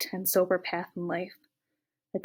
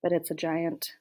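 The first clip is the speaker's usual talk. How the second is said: plain reading voice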